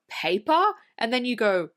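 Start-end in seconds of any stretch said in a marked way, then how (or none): 0.1-0.6 questioning rise on "paper?"; "paper?" as a disbelieving question; retold astonishment. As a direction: imitate amazement with "paper?" lifting at the end. Old guy's astonished reaction, retold.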